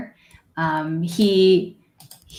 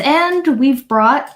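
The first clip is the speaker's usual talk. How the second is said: put-on slow, high proud old man; proud grandfather; 7 semitones higher, 6 dB louder than usual. Old man proudly presents his grandson to the party. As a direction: announce the grandson with pride.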